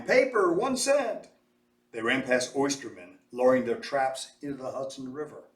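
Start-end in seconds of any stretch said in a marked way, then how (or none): 0.0-1.3 high calling-out street vendor; newsboy's cry. A narrator's reading. Newsboys call out to passersby, high street cry. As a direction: call it out like a newsboy selling papers. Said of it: "newsboys crying".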